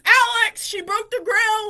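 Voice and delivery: loud, slow retold holler; tattling shout. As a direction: imitate a stepbrother yelling for his father. Stepbrother tattles again by name.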